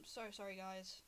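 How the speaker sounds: soft, low, contrite voice; subdued and sheepish; hushed apology. Acted. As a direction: apologize quietly, trailing away to almost nothing. Loma's sheepish apology to the drivers.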